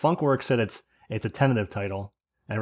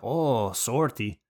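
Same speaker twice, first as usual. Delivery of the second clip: drawn-out mock French-Canadian apology; much slower than usual. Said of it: "a French Canadian, like, uh, apologizing".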